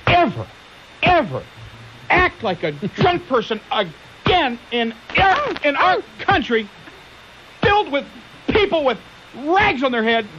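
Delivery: high, slow mock official growling a threat; threatening flogger. Host mocking the flogger for his listeners, high and menacing. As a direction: play the stern flogger warning a tourist.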